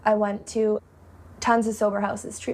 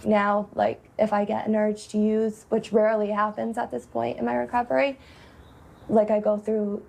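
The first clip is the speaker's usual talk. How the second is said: ordinary delivery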